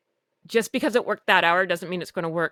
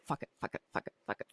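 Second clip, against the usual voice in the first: whispered under the breath, over and over; mumbled whisper; 15 dB quieter, much faster than usual. Retold or imitated. Walking through the house, whispering to silence the eating disorder voice. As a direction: mutter it to yourself like a chant. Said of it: "and I'm mumbling".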